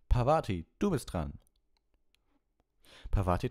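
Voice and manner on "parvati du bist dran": raised, higher call on the name. A narrator's reading. Lupin calls Parvati to her turn, calling out. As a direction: call the next pupil up. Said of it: "Professor Lupin rief".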